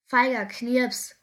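slow, loud jeering call; loud, drawn-out mockery; taunting call. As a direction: shout the taunt slowly. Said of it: "ruft er Melanie".

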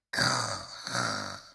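very low rumbling snore of the wind; sleeping wind snoring behind the clouds; deep snore. A narrator's reading. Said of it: "und schnarcht".

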